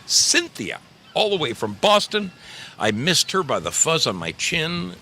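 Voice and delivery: excited greeting. Real, not acted.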